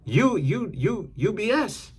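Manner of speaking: sing-song jab, like pointing a finger; mocking accusation. Calling out a bluffing Pisces as full of it, high mocking taunt.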